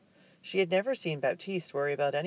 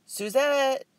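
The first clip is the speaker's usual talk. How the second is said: loud, much higher call from a distance; 12 semitones higher, 7 dB louder than usual. A narrator's reading. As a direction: call her name across the beach. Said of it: "someone called".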